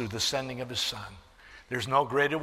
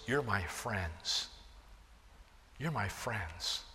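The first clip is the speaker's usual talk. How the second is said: tender; 5 semitones lower, 10 dB quieter, slower than usual. Acted. Jesus's tender words to friends.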